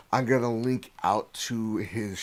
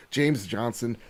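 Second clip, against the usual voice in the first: normal talking voice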